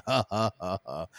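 imitated chuckle in separate huffs; put-on laugh, one ha at a time; Seth Rogen. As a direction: mimic a distinctive, evenly spaced laugh. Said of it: "he laughed like Seth Rogen".

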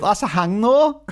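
put-on Scottish accent rising high and loud at the end; a Scottish voice climbing up on "though". Host doing a Limmy-style Scottish accent, rising high. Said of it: "watching the Limmy show".

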